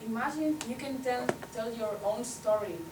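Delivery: ordinary talk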